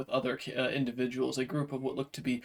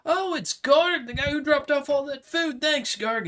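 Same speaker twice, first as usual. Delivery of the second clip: high, loud cheer of recognition; grateful call-out; 13 semitones higher, 6 dB louder than usual. Acted. Adventurers greeting Garg as he arrives, high grateful shout. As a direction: hail a friend across the camp loudly.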